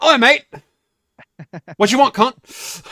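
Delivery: put-on loud, high, nasty London accent; rough British accent. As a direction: bark it like a rude British bloke. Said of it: "the only British accent you can do".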